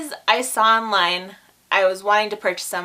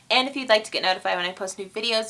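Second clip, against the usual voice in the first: normal talking voice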